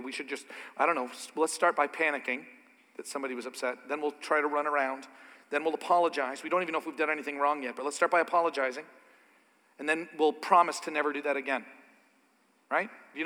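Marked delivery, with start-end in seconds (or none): 0.0-11.6 panicky rambling. Retold or imitated. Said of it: "I know lots of people that are like this".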